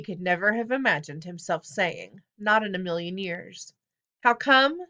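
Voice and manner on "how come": loud, higher furious yell; a director yelling at a defiant student; angry yell. A narrator's reading. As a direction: snap the words back in fury. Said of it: "he yelled".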